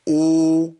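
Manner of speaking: long drawn-out vowel sound; held vowel. Shown as a demonstration. Teacher counting the vowel length for students. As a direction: stretch the vowel evenly. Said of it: "إن أنا أقول".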